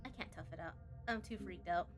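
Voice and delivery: normal talking voice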